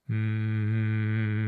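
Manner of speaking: closed-mouth humming sound; soft hum. Shown as a demonstration. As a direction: hum softly with lips closed. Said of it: "a bit like this".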